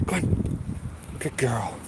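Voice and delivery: breathy whispered nudge to the dog; urging whisper. Real, not acted. Owner whispers to his dog on the walk, urging whisper. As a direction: hurry her along under your breath.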